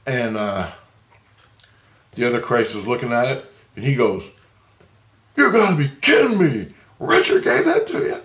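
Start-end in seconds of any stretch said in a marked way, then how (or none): 5.4-8.2 much higher, louder shock; can't believe what he sees; disbelieving. Retold or imitated. Other Christ disbelieving.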